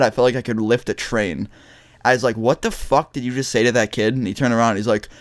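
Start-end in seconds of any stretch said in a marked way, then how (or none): none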